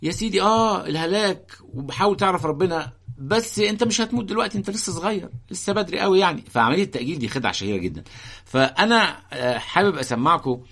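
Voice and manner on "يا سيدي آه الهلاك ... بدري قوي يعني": high, coaxing devil voice; a hint of a smooth tempter; b-movie acting. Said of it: "في فكرة شائعة يقول لك إيه".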